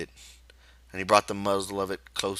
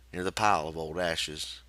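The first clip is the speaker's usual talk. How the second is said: usual speaking voice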